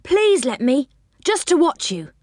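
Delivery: high, coaxing plea; hopeful little-brother pleading; begging. A narrator's reading. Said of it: "he begged".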